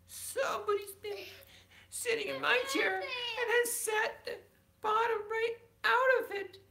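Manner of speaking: high, crying, squeaky tiny bear; little wee bear voice. Acted. Bedtime story, wee bear upset over his chair. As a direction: wail it like a heartbroken cub. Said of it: "in his little, small, wee voice".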